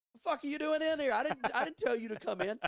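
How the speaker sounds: high, loud mock yell at the rider; angry yell. Crew chief yelling at his rider for pitting early, angry shout. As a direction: bark it like an outraged crew chief. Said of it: "Grant is sitting there on the pit box like".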